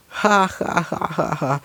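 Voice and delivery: high, mocking ha-ha-ha; villain laugh. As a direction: gloat over the beaten heroes.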